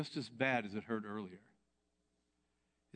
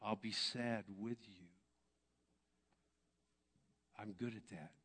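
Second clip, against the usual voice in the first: tender Jesus voice; 5 dB quieter than usual. Retold or imitated. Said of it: "What Jesus said to me was".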